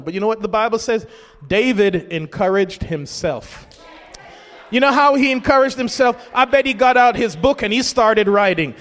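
ordinary delivery

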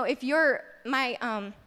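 ordinary talk

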